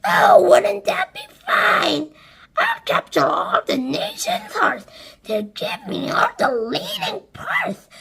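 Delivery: high, breathy swooning boast; dreamy vain aunt. A narrator's reading. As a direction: dream aloud of fame. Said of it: "and Sponge declared".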